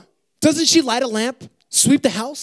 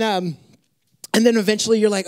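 normal talking voice

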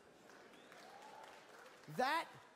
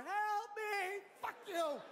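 put-on kid voice; 9 semitones higher, much slower than usual. Kid trapped, speaker voices him.

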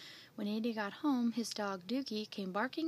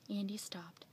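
ordinary delivery, nothing marked

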